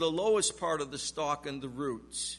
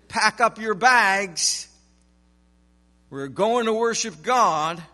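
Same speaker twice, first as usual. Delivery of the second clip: joyful announcement; 3 semitones higher, 9 dB louder, slower than usual. Retold or imitated. Imagined foremen announcing release.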